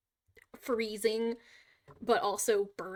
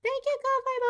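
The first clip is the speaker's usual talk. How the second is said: robot voice; 4 dB louder than usual. Pretend. Reading a donation bot alert.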